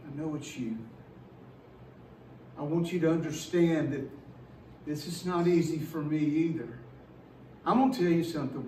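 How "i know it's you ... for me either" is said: soft, low reassurance of a father; God answering his son, softly and tenderly. Retold or imitated. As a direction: comfort the son gently and quietly. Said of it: "I think God said".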